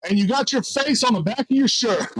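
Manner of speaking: high, excited mock exclamation; Joey. Customer spotting the face on the shirt, imitated as high, excited exclaiming. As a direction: imitate someone amused and amazed, pitch way up. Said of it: "He goes".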